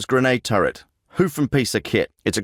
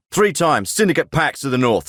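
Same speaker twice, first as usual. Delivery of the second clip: ordinary talk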